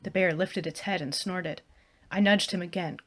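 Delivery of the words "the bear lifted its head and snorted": usual speaking voice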